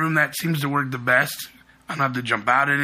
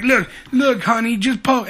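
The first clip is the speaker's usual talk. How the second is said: higher-pitched, lightly acted coaxing; mock insistent husband; 7 semitones higher, 3 dB louder than usual. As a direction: imitate a husband urging his wife on. Retold husband urges wife to poke.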